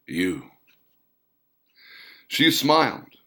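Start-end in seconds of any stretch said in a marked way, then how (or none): 0.1-0.5 lightly husky, still waking up; a hint of sleepy huskiness; drowsy, murmured husky reply; husky, half awake. A narrator's reading. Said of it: "He said in a husky tone, a tone of someone not quite being awake yet".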